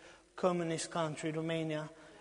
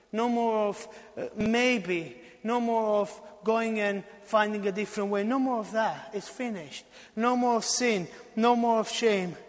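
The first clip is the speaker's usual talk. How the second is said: louder, higher preaching with repeated blows; rising preaching; 5 semitones higher, 6 dB louder than usual. Acted. Preacher declaring freedom, loud and high. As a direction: build with each no more.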